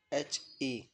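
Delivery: ordinary delivery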